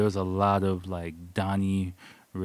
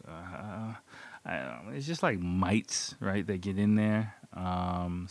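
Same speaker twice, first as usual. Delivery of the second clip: usual speaking voice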